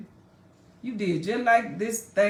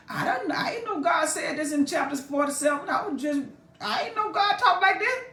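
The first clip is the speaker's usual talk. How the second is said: high, laughing amazement; laughing disbelief; 7 semitones higher than usual. Real, not acted. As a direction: marvel through a laugh. Amused disbelief while laughing.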